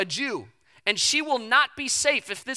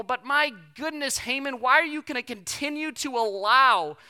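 ordinary talk

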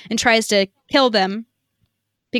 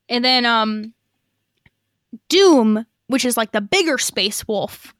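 ordinary talk, nothing marked

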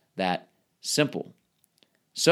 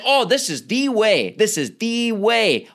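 insistent quote; 7 semitones higher, 3 dB louder than usual. Retold or imitated. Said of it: "You might say".

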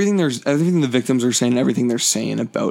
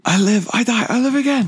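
Nux impression; 11 semitones higher than usual. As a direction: shout it like a crazed war boy. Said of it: "Nicholas Hoult's line".

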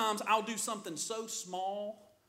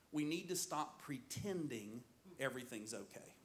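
soft low key point; preacher drops to a serious hush; quiet, earnest; 7 semitones lower, 10 dB quieter than usual. Acted. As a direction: say it softly so it lands.

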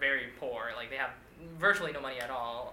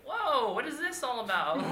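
mock surprise; 8 semitones higher, slower than usual. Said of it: "And I'm like".

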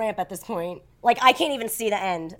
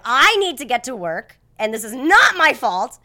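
louder, pleading and slowing down; stuck at a parking gate, begging the attendant, raised voice; freaking out; 6 dB louder, slower than usual. Retold or imitated. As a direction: demand to be let through, getting desperate. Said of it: "I start freaking out".

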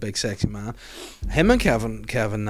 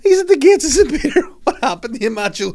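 laughing; 11 dB louder than usual. Retold or imitated. Mock heavenly gatekeeper, laughing.

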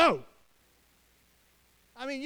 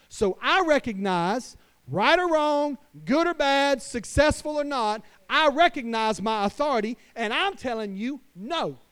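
full-force preaching; 2 semitones higher, 5 dB louder than usual. Acted. Pastor preaching to fathers about authority, at full force. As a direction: preach it with rising conviction.

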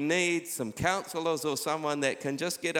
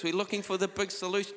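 usual speaking voice

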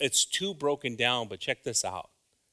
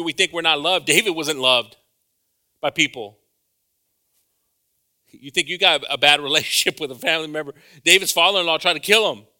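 louder preaching, building to a near shout on David's trials; fervent preaching; 4 semitones higher, 9 dB louder than usual. Real, not acted. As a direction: press the congregation with David's example. Preaching with rising force.

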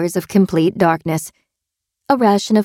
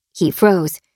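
usual speaking voice

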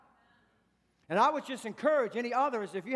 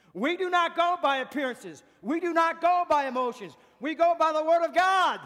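loud, higher preaching, building; emphatic declaration; 5 semitones higher, 6 dB louder than usual. Real, not acted. Preacher laying down the church's rule, forceful and building. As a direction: proclaim it forcefully, building to the last phrase.

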